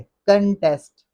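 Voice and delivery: कंटेस्ट very slow, kunnn-TEST; correct pronunciation. Shown as a demonstration. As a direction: linger on the n of short kun in कंटेस्ट. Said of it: "कंटेस्ट है". (कंटेस्ट धीरे, कन्न... टेस्ट)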